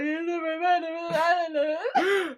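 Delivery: put-on high, long-drawn drunk whine; mock drunken wail. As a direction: drag it out like a sobbing drunk. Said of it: "You'd think he was drunk".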